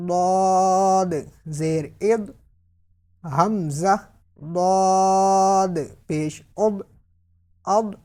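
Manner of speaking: very long held "ضاد", then short "اد"; slow letter-by-letter recitation; spelling drill. Shown as a demonstration. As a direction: stretch "ضاد" far out, heavy and steady.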